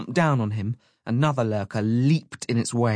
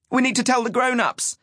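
put-on high-pitched child's yell of alarm; urgent yell; 13 semitones higher than usual. A narrator's reading. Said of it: "Ash yelled".

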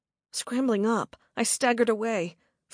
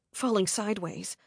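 ordinary delivery, nothing marked

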